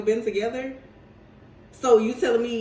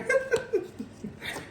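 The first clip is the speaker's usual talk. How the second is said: breathless high laugh; 14 semitones higher, 6 dB quieter than usual. Real, not acted. Laughing at the tarot card.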